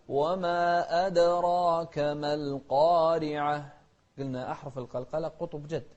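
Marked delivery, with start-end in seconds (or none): 0.1-3.7 أدراك with a bouncing, echoing dal. Shown as a demonstration. Teacher shows the bouncing dal in أدراك. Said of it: "شوف القلقلة".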